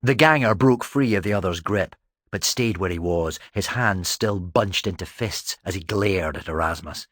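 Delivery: ordinary delivery, nothing marked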